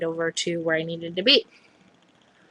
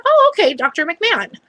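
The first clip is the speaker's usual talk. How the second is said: high, breezy mock recognition; imitated chirpy acknowledgement; imitated helpful colleague; 9 semitones higher, 4 dB louder than usual. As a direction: mimic her easy agreement, pitched up. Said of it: "She goes".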